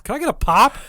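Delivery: high, loud sneering mimicry; mock northerner asking for a pop; mocking pop-asker. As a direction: ham up how silly it sounds.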